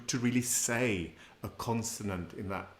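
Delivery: usual speaking voice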